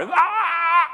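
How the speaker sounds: high, loud hammed-up shout; mock battle cry. Shouting at the customer.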